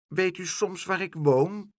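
put-on high small child's voice; a lost little boy asking timidly. A narrator's reading. Lost boy asks Pluk the way, small high child voice.

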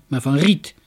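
ordinary delivery

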